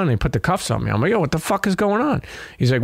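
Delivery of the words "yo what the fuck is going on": shocked outcry. Retold or imitated. Retold shock at being cuffed.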